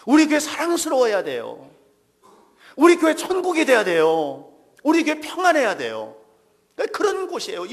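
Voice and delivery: loud, fervent sermon delivery; preaching at full force. Acted. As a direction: preach it out with full conviction to the congregation. Preaches loudly.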